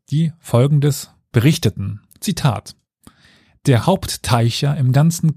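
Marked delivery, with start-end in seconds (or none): none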